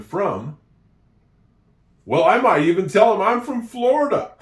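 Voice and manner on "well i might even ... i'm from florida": higher and louder, laughing through the words; amused at the joke, laughing it out. Real, not acted. Telling learners a joke about Florida, laughing.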